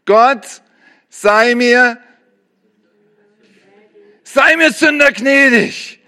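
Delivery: high, slow, imploring plea; pleading sinner's prayer. Acted. Preacher acting a sinner begging God for mercy, pleading. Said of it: "dass du dir an die Brust schlägst und sagst".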